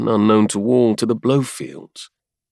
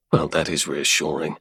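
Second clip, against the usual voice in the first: sarcastic; 5 semitones lower, 4 dB quieter than usual. A narrator's reading. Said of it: "he said sarcastically".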